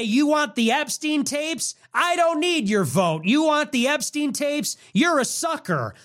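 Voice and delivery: high sneering mock of a smug politician; lightly voiced smug GOP politician, mocking. Mocking the party that dismisses its voters, high and sneering. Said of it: "They go in July and say".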